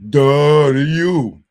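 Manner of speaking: loud, drawn-out mocking duh; mocking comeback. Real, not acted. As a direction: throw it back loudly, stretched out.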